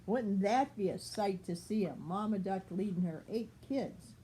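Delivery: plain reading voice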